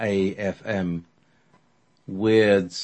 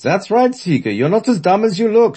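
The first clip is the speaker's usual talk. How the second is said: loud, high German shout; mock him loudly in German accent; 12 semitones higher, 8 dB louder than usual. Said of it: "shouts Eckert".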